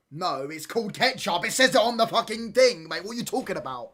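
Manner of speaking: loud, high-pitched outraged rant; indignant shout. Acted. Arguing back at people who say red sauce, indignant shouting. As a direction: yell back in exasperated disbelief. Acted outburst in ketchup argument.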